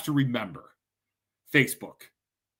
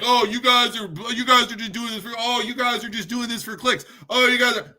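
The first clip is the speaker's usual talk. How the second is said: a light sneering mimic of an online critic, higher; mocking commenter; 5 semitones higher than usual. Mocking critics in the comments, higher sneering mimic. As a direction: mimic the snide commenter, pitched up. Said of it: "say stuff like".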